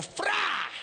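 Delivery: sharp high mock neck-snap noise; wringing sound. Grandma wrings the hen's neck, sharp mock sound. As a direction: spit out the kill sound. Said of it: "mi abuela decía".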